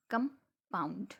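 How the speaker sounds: Compound broken in two, a short break after कम; split into syllables. Shown as a demonstration. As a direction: say compound as two pieces, कम, then pound. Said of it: "बीच में थोड़ा सा break दे के". (Compound को बीच में रुककर दो टुकड़ों में बोलें)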